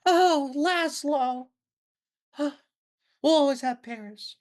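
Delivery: high, drawn-out mock distress with a gasp; film heroine in distress. Mocking old film actresses to the co-host, in high melodramatic distress. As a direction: swoon like an old-movie damsel. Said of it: "a lot of the women just speak in, like, they're in distress".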